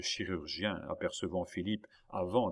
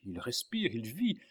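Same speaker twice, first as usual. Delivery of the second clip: high excited cry, rising to loud; exclaiming; 5 semitones higher, 4 dB louder than usual. A narrator's reading. Young man feeling a heartbeat in the boy, crying out. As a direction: build up to an insistent outcry. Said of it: "s'écria Philippe".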